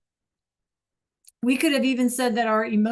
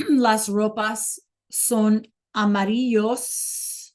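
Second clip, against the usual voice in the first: slow model sentence; much slower than usual. Shown as a demonstration. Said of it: "teacher models Spanish slowly".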